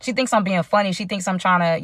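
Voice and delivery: ordinary talk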